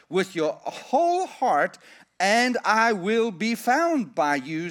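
slow, high, ringing pulpit reading; solemn scripture reading. Acted. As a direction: proclaim each line slowly from the pulpit. Scripture reading at full force.

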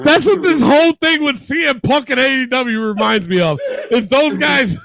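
words bubbling through laughter; chuckling through the point; laughing while talking. Real, not acted. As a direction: still cracking up as he explains. Laughing after the played clip.

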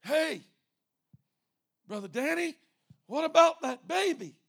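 high, loud challenge; calling out. Acted. Pastor calling to Brother Danny about the baby, loud and high. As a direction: holler across to catch someone out.

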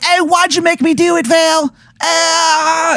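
very high-pitched, whiny Baltimore character voice; bad Baltimore accent. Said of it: "this Baltimore accent".

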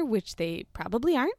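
normal talking voice

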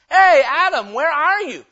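loud, high call across the garden; God calling Adam. Acted. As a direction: shout for him to come out of hiding.

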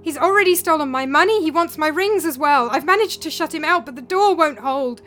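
loud, high, panicked pleading; strained desperate cry. A narrator's reading. Philip calling to Demos in a dream for rescue, loud and panicked. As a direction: yell for help as if cornered. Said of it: "his voice was strained and rasping".

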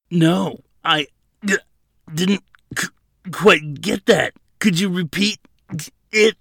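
strangled, high, halting words; choked defiance. A narrator's reading. Prisoner held by the throat mocks the officer, choking. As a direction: force words out in broken bursts against a choke. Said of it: "said with a red-faced grin as a little bit of saliva dribbled".

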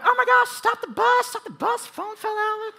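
very high falsetto mock shriek; hammed-up squealing panic, high and loud; freaking-out girls yelling. Girls yelling at driver to stop the bus, freaking out. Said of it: "start freaking out, and they start yelling".

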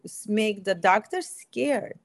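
ordinary talk, nothing marked

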